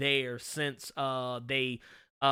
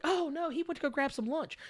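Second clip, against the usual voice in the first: high, sweet put-on wife voice; the wife's reply; 12 semitones higher, 6 dB quieter than usual. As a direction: answer politely like the wife. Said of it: "she'll be like".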